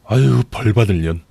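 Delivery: a hint of an old mother spitting a curse; mother cursing. Mother cursing the mistress, a touch of mock scorn. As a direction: curse her the way mother always does, mildly. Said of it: "라며 한바탕 욕을 하시곤".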